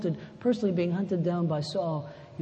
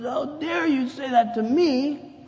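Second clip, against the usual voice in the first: indignant; 8 semitones higher, 5 dB louder, slower than usual. Retold or imitated. Spouse offended in argument.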